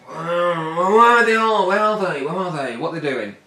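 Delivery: peer round anxiously and trail off; nervous Roman soldiers. Acted. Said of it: "his guys are going".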